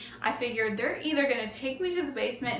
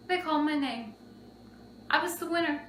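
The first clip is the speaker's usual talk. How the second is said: normal talking voice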